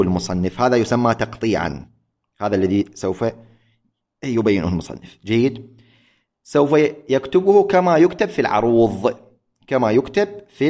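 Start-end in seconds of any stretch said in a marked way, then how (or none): none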